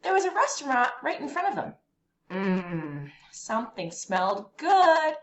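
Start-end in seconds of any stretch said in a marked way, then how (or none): none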